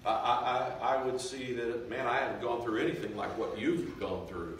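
ordinary talk, nothing marked

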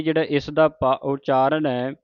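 ordinary talk, nothing marked

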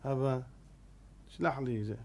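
soft, low and calm; calm gentle request. Retold or imitated. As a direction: ask your father softly. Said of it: "הוא היה רגוע". (בקשה שקטה ורגועה מהאב)

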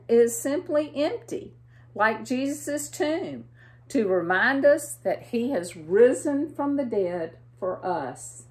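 usual speaking voice

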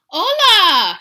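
very loud, high, held greeting; holler. A narrator's reading. Hero answers his barking buddies, hollering high. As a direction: holler it back at full voice. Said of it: "hollered back Scapito Frisquito".

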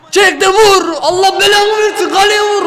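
high, frantic yell; shouting at the game. Real, not acted. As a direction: scream at the striker to shoot. Yelling at players in match. (maça bağırarak)

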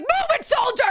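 high, loud mock military order; imitated urgent shouted command; barking drill sergeant. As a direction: yell it like an instructor driving troops. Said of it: "he's like".

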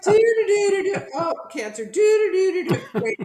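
high, laughing sing-song babble, half shouted; laughing mock panic. Telling the host about the cancer news, laughing high. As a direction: giggle through it like panic is a joke. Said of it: "I'm like".

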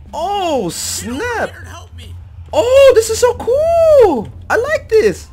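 very high-pitched excited squeal; thrilled squealing. Real, not acted. Player discovering the cool map, thrilled squealing. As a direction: squeal with delight, stretching the words.

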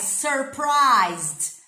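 "surprised" loud, each syllable stretched and mouthed fully; over-articulate "surprised" with a curled r and long "prised"; exaggerated articulation demo. Shown as a demonstration. Teacher showing students her mouth, "surprised" hugely articulated. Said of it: "Olha a boca aqui da teacher".

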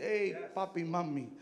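ordinary delivery

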